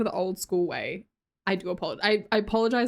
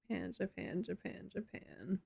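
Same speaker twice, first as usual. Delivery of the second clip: very soft repetition while jotting it down; hushed note to self; muttering to oneself; 14 dB quieter than usual. Real, not acted. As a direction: repeat it under your breath. Repeats it to herself while adding.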